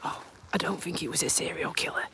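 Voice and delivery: soft relieved whisper; whispered aside to viewers. Real, not acted. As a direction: keep it hushed until he's out of earshot. Still hushed after passing walker.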